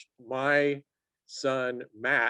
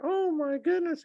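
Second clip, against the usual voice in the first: very high, squeaky whine of outrage; squeaky whiner. Retold or imitated. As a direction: squeak it like a whiny coach. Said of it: "it's so squeaky and weird".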